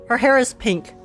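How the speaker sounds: ordinary talk, nothing marked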